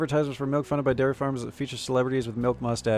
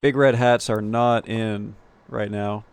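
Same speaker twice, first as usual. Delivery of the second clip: ordinary talk, nothing marked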